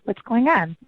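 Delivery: ordinary talk, nothing marked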